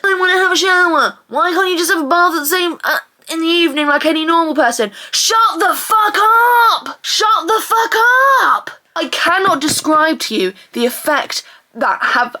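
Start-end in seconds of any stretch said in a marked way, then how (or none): 0.0-4.9 mocking imitation of a harassing brother; nagging brother. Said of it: "My brother starts fucking harassing me". 5.1-8.7 high, drawn-out scream of rage; furious yell. Acted. Yelling back at the brother.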